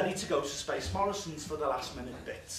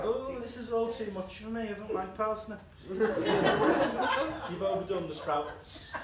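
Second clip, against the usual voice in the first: higher moaning granny voice; 6 semitones higher, 6 dB louder than usual. Retold or imitated. Nan moaning at the family over Christmas dinner, in a whiny granny voice. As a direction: whinge like a fed-up old nan at Christmas dinner. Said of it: "she's sitting there like that".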